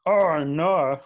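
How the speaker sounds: "Oh, no" said loud with an added R; mock British oh no. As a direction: copy the shocked British "Oh, no". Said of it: "they say".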